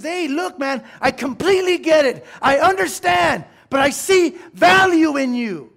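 high, loud pleading shout; impassioned plea. Acted. Outreach worker pleading with a hurting man, shouting loudly. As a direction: beg him to see his own worth. Preacher voices someone reaching out.

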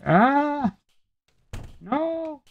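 high, sudden startled yelp; startled cry. Real, not acted. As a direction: yelp in surprise. Sudden danger in the game.

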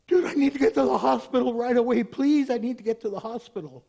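high, loud acted plea for help; urgent plea. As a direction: imitate a desperate stranded driver begging. Retold stranded driver begging for help.

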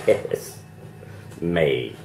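normal talking voice

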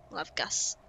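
usual speaking voice

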